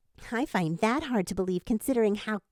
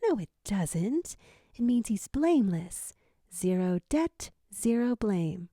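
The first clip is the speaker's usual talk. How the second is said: tender mother; 4 semitones lower than usual. A narrator's reading. Mother tenderly correcting father.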